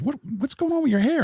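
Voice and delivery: put-on high, concerned old neighbor lady. Neighbor lady asking a boy about his bloody hair, high concerned voice. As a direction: lightly mimic a worried neighbor woman. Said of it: "Miss Culver, says".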